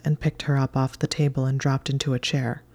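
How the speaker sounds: ordinary delivery, nothing marked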